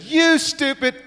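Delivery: high-pitched imitation of an angry little brother; really mad. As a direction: squeal the insult like a furious child. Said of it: "got really mad".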